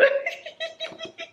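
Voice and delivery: high, giddy burst of laughter. Real, not acted. Fan laughing giddily at a surprise book. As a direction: laugh out the joy.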